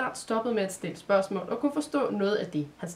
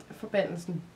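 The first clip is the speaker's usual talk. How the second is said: ordinary delivery, nothing marked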